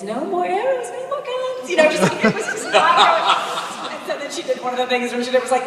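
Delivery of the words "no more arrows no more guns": rising higher and higher on the last words; a mock line reading climbing way up; line reading, descending. Shown as a demonstration. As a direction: show him how it should soar. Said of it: "finally she was giving him line reading".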